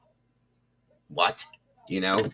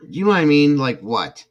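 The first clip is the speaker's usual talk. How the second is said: incredulous; 8 semitones higher, 5 dB louder than usual. Real, not acted. Disbelief at girlfriend's words.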